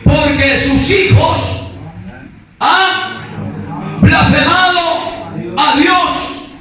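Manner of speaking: high, slow preaching with long pauses; declaimed scripture. Acted. Preacher having the congregation follow the verse, emphatic declaiming. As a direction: proclaim it word by word, pitched high.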